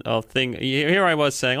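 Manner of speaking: ordinary talk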